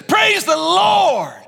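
high, slow, drawn-out shout of praise; joyful shout. Retold or imitated. Woman's final reply to the man.

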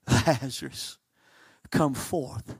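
soft, low, endearing Lazarus, Lazarus; softly calling a dead friend by name; tender call. Acted. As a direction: call him out gently like a loved friend. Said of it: "calls forth with a term of endearment".

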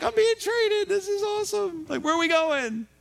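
way up high, giddy and sarcastic; mock thrilled. Joseph thrilled to be sold off by the traders, hammed-up giddy. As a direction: play Joseph as cartoonishly delighted.

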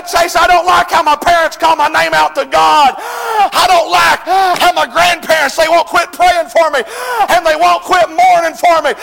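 loud, high, shouted sermon; fiery revival preacher shouting; full-force preaching. Acted. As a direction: preach it hard at the congregation.

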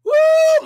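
a high, loud preacher's whoop; sudden high woo of worship; ecstatic woo. Real, not acted. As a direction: let out a joyful shout of praise. Ecstatic cry mid-sermon.

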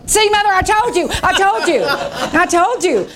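high, laughing sing-song gloat; gloating told-you-so. Retold or imitated. Preacher telling her mother she was right, gloating with laughter. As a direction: crow with delight that you were right.

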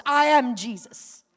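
slow, solemn divine voice rising loud; a hint of a solemn divine voice; voice of Jesus. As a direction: announce it with weight. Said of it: "but I heard a voice".

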